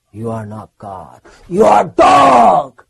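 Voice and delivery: imitated guru's thunderous put-down; imitating Prabhupada. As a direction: build from a grave rebuke to a booming shout. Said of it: "he said it in such an amazing way".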